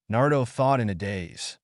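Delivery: plain reading voice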